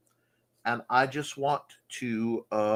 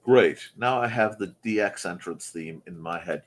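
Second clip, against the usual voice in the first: usual speaking voice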